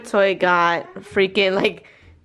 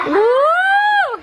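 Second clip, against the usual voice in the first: excited whoop; 4 dB louder than usual. Real, not acted. Reacting to baby's pose outfit.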